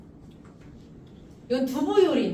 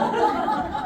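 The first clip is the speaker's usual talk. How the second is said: burst of laughter; 11 semitones higher, 3 dB louder than usual. Real, not acted. Laughs at own story.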